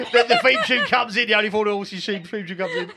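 talking through laughter; laughing. Real, not acted. Co-host adding to the running bit, laughing. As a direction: get it out while cracking up.

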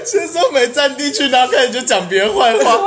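high-pitched talk through laughter; helpless giggly delivery; laughing. Real, not acted. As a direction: crack up while getting the words out. Laughing while teasing herself.

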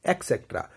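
low, soft एक्सेट्रा with a k; common mispronunciation. Shown as a demonstration. Teacher showing the EC error, common mispronunciation. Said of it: "ई सी करके बोल देते हैं".

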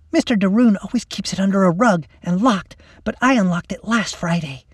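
put-on high, eager girl's voice; pleased young plotter; scheming schoolgirl. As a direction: explain your clever plan to a friend. Lacey explains her scheme.

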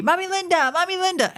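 put-on high calling yell of a child; mock yell. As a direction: call out Mommy Linda way up high. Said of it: "starts yelling at me".

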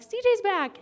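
high, loud happy call; joyful shout across the room; excited greeting. Retold or imitated. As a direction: call out the welcome like a friend spotting you. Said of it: "and he was like".

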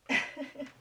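short uneasy giggle; nervous laugh. Real, not acted. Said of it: "that's a nervous laugh".

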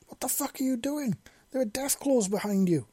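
high, panicked yell; fearful yelling. A narrator's reading. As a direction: scream the warning in real fear. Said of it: "the man yelled with genuine fear in his voice".

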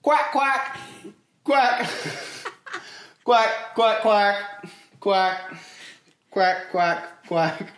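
loud high quacking duck; high duck quacks. Pretend. Duck noises in sound game.

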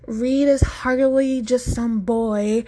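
slow, loud, insisting; stressed insistence. A narrator's reading. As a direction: press every word home. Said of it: "Kaya stresses".

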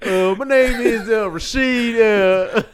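high, loud, halting alias voice; put-on fake identity. Banned fan giving a false name at the gate, high put-on voice. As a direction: stall through a made-up name while cracking up.

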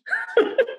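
short high laugh; a quick laugh mid-sentence; light laugh. Real, not acted. Guest laughing while telling the interviewer a story.

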